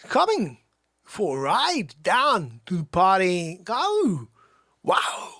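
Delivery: slow, halting, punched words; mock movie-star cadence; Christopher Walken. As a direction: lean oddly on single words. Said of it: "Christopher Walken".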